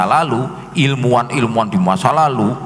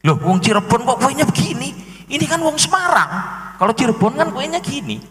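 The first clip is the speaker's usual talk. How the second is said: higher, quick incredulous challenge; mock disbelief; 9 semitones higher, faster than usual. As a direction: call out the fake local, amazed. Real Cirebon man doubting, mocking. (meniru orang Cirebon asli yang heran, cepat)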